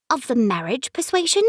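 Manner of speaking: put-on British accent. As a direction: play it as a mock-formal British accent. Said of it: "Daphne joked in a British accent".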